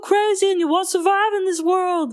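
high-pitched, hammed-up character impression; accidental comedian-like high impression; Matthew McConaughey's character. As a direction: exaggerate an urgent warning in a high put-on voice. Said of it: "That sounded like Jerry, uh, Seinfeld".

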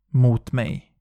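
usual speaking voice

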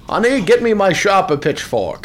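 a hint of a farmer calling to his wife. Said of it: "He would be that one farmer".

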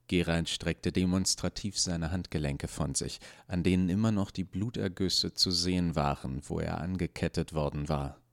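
plain reading voice

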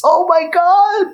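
very high, drawn-out rave; gushing delight. Real, not acted. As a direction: stretch it out, overcome by how good it was. Gushing over favourite film scene.

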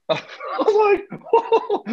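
high, laughing whoa; real laughter breaking through a high whoa. Real, not acted. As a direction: burst out laughing in disbelief. Cracking up at dad's line.

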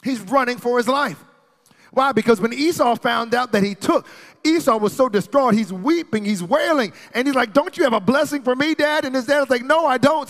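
high, loud, driving sermon delivery; full-force preaching. Real, not acted. Preacher retelling Esau's fury to the congregation, full-force preaching. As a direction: preach the story hard, pitch climbing.

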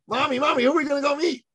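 put-on very high, eager child voice; excited little boy. Little son asks his mommy who they'll meet, excited. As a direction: squeal it like an excited small boy. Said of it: "little boys do with excitement".

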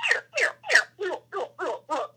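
high, falling animal calls; imitated elk chuckle. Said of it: "like, this really weird chuckle".

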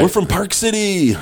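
high, drawn-out pretend call-out; mock group cheer. Utah tourists dodging the announcer's reaction, high mock cheer. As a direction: sing out the hometown like an eager tourist.

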